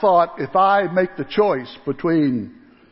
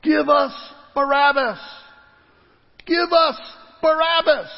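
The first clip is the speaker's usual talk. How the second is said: crowd cry; 7 semitones higher than usual. Acted. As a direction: shout it up as the mob. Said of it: "they begin to cry out".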